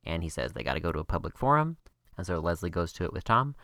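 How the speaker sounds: rapid-fire rundown, racing through; speed-talking a plot summary; talking really fast. Real, not acted. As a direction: rush it like you're against the clock. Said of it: "I'm gonna talk really fast".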